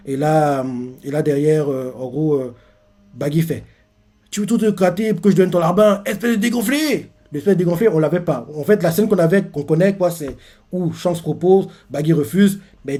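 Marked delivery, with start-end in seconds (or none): 4.3-7.1 fast high retort rising into the insult; acted outrage, climbing in pitch; indignant insult. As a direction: hurl a cartoonish insult. Baggy's refusal and insult acted out.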